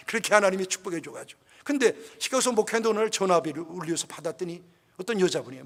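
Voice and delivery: ordinary talk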